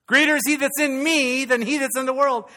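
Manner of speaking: high, loud preaching shout; full potency. Shown as a demonstration. Preacher repeats the verse to put potency back in, loud rallying cry. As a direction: belt out the verse with real force. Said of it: "Didn't even have any potency right there".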